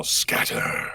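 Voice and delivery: soft breathy whisper; Omen voice line. Acted. First line for chat on camera, whispered. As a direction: breathe it quietly. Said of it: "well, first of all".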